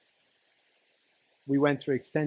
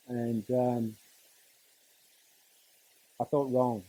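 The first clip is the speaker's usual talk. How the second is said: normal talking voice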